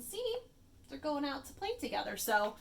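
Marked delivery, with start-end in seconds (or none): none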